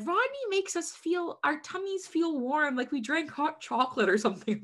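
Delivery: put-on high, sweet little kid voice; imitating a gushing schoolkid. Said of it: "that they said".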